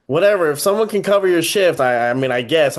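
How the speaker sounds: loud, grudging retold reply; she's like. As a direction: put on an exasperated, grudging tone. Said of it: "So she's like".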